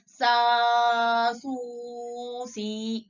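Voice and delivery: सा, सू, सी dragged far too long; overlong stretch. Shown as a demonstration. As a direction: overdo the stretch deliberately. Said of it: "बहुत ज्यादा खींचना है". (सा, सू, सी को ज़रूरत से ज़्यादा खींचें)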